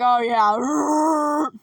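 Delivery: Lurch's long drawn-out growl; Lurch impression. Lurch answers the family's introductions, drawn-out groaning reply. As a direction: trail the word off into a grunt. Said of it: "And Lurch is like".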